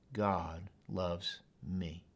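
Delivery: God loves me said softly and slowly; hushed, slow affirmation; slow quiet declaration. Shown as a demonstration. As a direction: speak it gently to yourself. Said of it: "Just say it out loud".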